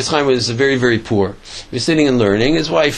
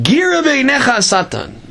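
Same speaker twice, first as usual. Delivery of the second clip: higher-pitched, emphatic rebuke; retold defiant outcry; fist-thumping defiance; 10 semitones higher than usual. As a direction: declare it like pounding the table at an enemy. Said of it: "smacked his fist on the table".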